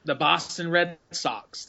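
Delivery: slow, demonstrated Boston accent; drawn-out Boston accent, every word a little accented. Shown as a demonstration. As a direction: lean slowly into a Boston accent. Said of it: "everything is a little accented".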